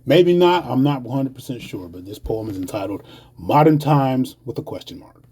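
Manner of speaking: ordinary talk, nothing marked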